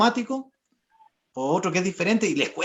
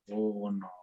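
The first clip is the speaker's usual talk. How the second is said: much softer uno; counting softer; 9 semitones lower, 13 dB quieter than usual. Shown as a demonstration. Teacher counting while lowering the volume, softer. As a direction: drop the volume right down. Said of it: "pero bajando".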